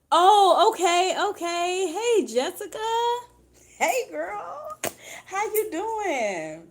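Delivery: high, loud delighted hello; sudden squeal of joy at a name; excited greeting. Real, not acted. Greeting a viewer named Jessica.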